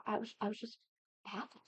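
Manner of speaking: ordinary talk, nothing marked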